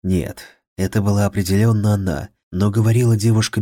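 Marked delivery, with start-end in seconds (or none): none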